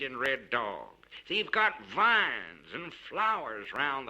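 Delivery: a traveling expressman, playfully boasting about Roaring Camp; boastful expressman. Acted. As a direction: spin it like a proud gossip passing on news. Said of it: "He would say".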